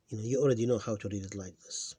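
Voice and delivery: ordinary talk, nothing marked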